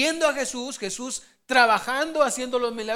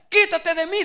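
rebuke; 7 semitones higher, 6 dB louder than usual. Acted. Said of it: "le dice Jesús".

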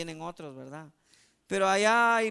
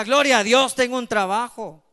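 loud preacher's rallying cry; forceful, much louder proclamation; exultant praise shout; 11 dB louder than usual. Acted. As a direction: belt out the praise for the congregation to echo. Preacher urges congregation to proclaim praise.

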